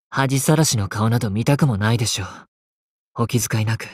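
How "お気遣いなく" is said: ordinary talk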